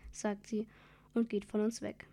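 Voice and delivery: usual speaking voice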